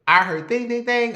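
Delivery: high sung ding-ding; phone ding imitation. Retelling hearing a text arrive, high chiming dings. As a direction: chime like a phone alert.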